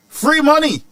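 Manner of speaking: sarcastic shout of free money; mock shout. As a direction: blurt it out like a jeering taunt. Mocking politicians' slogan.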